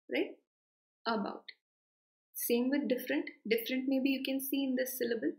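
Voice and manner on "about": About opening on 'uh'; schwa start. Shown as a demonstration. Teacher models the first sound, About starting with schwa. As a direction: say About as 'uh-bout'. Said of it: "It starts with the a sound".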